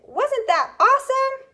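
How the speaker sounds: exclaiming. Shown as a demonstration. Teacher modelling an exclamation for students, thrilled and high. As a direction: burst out with delight. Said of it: "So you're exclaiming".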